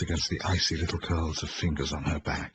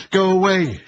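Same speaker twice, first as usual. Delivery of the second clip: screaming high and loud at the door; wild shout; 13 semitones higher, 11 dB louder than usual. A narrator's reading. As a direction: yell it out in panic. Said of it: "she shouted wildly".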